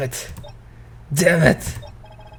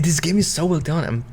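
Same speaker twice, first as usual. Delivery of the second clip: normal talking voice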